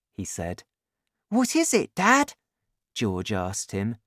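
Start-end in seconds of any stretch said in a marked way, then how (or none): 1.3-2.3 put-on much higher child's voice; young son. A narrator's reading. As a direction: ask Dad in a high boyish voice. Narrator voices young son George.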